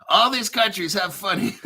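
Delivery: starts the line, breaking into giggles; laughing. Real, not acted. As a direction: try to get it out before the laugh takes over. Breaks up laughing at own joke.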